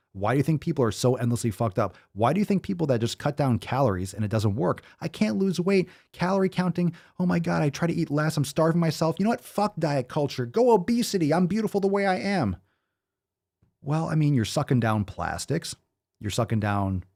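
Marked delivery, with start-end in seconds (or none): 5.0-12.6 high, whiny mocking voice of a dieter; hammed-up self-pitying dieter. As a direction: sneer at them in a high whine.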